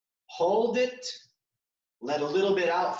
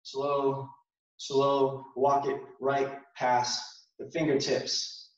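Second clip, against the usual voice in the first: guided exercise cues; 4 semitones lower than usual. Acted. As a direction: guide them gently through each movement. Guides a slow qigong movement sequence.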